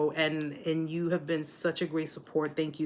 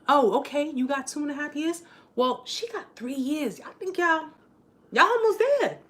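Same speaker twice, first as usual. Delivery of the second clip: lightly voiced matchmaker, higher pitch; 7 semitones higher than usual. Matchmaker quizzing survivors on their healing, playful higher voice. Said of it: "I'll be like".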